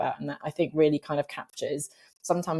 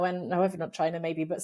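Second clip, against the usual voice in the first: ordinary talk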